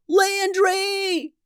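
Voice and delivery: very high, desperate cry of a name; alarmed scream. A narrator's reading. Boulise rushes to rescue baby Landry, screaming. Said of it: "screamed Boulise, rushing forth".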